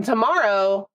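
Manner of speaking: normal talking voice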